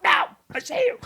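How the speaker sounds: high-pitched yelp at a pest; mock yell. Roommate calling out the mouse she spotted, mock yelling. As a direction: yell it high, imitating yourself startled.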